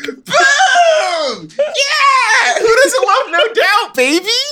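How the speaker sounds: laughing cheer. Real, not acted. Cheering for No Doubt.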